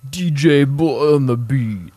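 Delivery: slow, loud, high announcer drawl; DJ producer tag. Pretend. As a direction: call out the producer tag like a beat intro. Imitating a beat producer tag.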